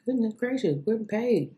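exasperated high voice. Real, not acted. Frustrated searching for bakery page.

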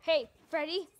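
loud nervous warning; scared shout. Real, not acted. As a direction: yell at it to back off. Warding off Funtime Freddy.

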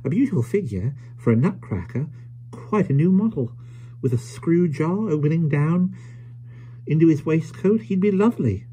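high-pitched, marvelling little voice; admiring old toymaker. Acted. Caleb picturing the stranger as a nutcracker, high admiring voice. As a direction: size him up in a high, swooping voice.

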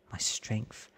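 plain reading voice

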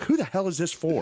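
put-on high, incredulous old man; mock confused father; baffled dad impression. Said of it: "It's like".

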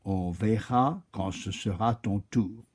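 low, soft, calm oak voice; patient and unmoved. Acted. The oak sends the chameleon back, low and calm. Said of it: "répondit le chêne tout aussi calmement".

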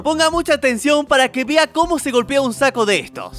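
ordinary talk, nothing marked

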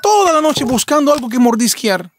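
high, fussing, motherly fox voice; fussing over a hungry husband; doting fox. Acted. Fox to her husband, fussing tenderly.

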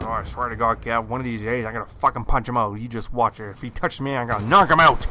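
loud, heated retold threat; angry threat. Water truck guy vowing to punch coworker, heated retold threat. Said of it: "he says".

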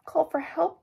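high, urgent story-time cry; high-pitched acted cry. A narrator's reading. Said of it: "cried Bebe".

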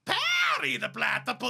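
high cartoon villain accent; high, pushed villain whine; Doofenshmirtz voice. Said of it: "That was way better".

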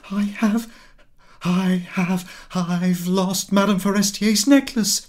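high, stammering panic; wife confessing she lost the borrowed necklace; panicked cry. A narrator's reading. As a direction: blurt it out in rising dread. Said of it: "she cried".

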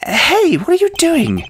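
put-on very high, startled young voice; high character voice. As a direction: pitch way up in surprise. Voices Judy.

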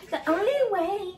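high mimicking child's voice; mocking know-it-all. Mother mimicking her child's claim, mocking high voice. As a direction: parrot it back high, to tease. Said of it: "Y vienes acá muy chingona".

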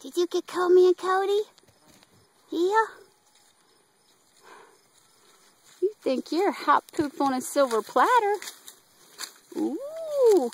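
high playful voice for a pet; pet talk. Real, not acted. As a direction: coo up high and teasing. Cooing at the pet.

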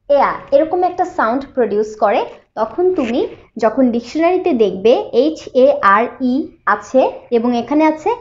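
usual speaking voice

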